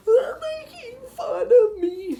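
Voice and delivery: very loud, high, slow wail; mock crying. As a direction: bawl it like a tattletale. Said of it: "start crying".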